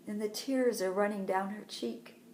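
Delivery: plain reading voice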